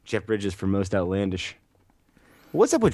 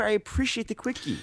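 high, softer, altered voice; high put-on voice; 9 semitones higher, 6 dB quieter than usual. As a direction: repeat it in a silly put-on voice. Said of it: "repeated line as a bit".

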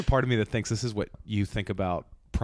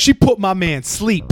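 loud, high mocking commenter; 8 semitones higher, 11 dB louder than usual. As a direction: cackle it like a comment.

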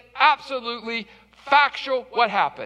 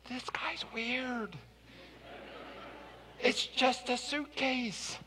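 whispered aside; 3 semitones higher, 12 dB quieter, slower than usual. Real, not acted. Aside about the clerk.

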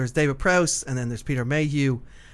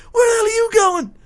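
high, loud mock yell; imitating Chewbacca's yell; 8 dB louder than usual. Retelling the actor yelling his line, loud and high. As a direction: belt it out like the actor in the mask. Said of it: "he's just yelling".

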